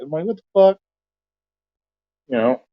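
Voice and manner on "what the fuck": sharp, high incredulous yell; short high outburst of disbelief; yelling at the phone. Retold or imitated. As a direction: spit it at the phone. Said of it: "I'm yelling at my phone".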